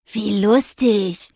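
slow, drawn-out mockery; mocking cat mew. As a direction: say it like a scornful cat. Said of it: "maunzte der Kater spöttisch". (spöttisch miauend)

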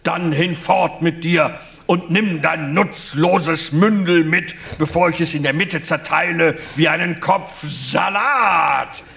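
bellowing knight, shouting; roaring. A narrator's reading. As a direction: roar him out of the castle. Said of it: "brüllte Romuald der Schlechter".

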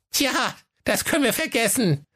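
very high goat voice, resigned. A narrator's reading. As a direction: sigh it like defeated goat kids. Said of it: "dachten die sechs Geißlein". (hohe, resignierte Geißleinstimme)

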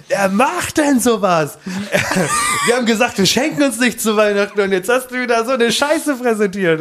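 high, loud mock-outraged yell; retold screaming. Comic re-enacts a row with the flatmate, mock screaming. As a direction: yell it like an exasperated flatmate in a skit. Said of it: "im Gegenzug so geschrien".

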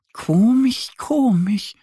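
hohe Entenstimme, nachdenklich vor sich hin; murmelnde Ente. Acted. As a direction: murmel es wie eine grübelnde kleine Ente. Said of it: "murmelt die kleine Ente". (nachdenklich murmelnde Ente)

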